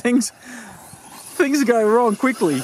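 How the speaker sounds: slow, high, laughing words. Real, not acted. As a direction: chuckle through the line. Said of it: "I'm laughing at my own silliness".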